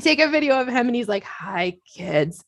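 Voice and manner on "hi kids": imitating careful, drawn-out groggy speech; very slow. Groggy husband greets kids on video, slow careful imitation. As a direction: space each word out, trying hard not to slur. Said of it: "he's talking so slow".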